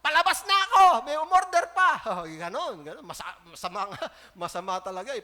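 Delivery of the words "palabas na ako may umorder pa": loud, high hammed-up complaint; mock angry rant. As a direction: play the irritated worker who wants to leave. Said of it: "galit na galit". (kunwaring galit na reklamo)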